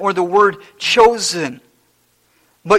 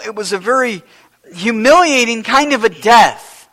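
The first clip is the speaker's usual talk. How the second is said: rising and louder, driving the sermon home; preaching at full force; 4 semitones higher, 5 dB louder than usual. Acted. Pastor urging the congregation about Jesus' lowly life, fervent preaching. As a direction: build the fervour line by line.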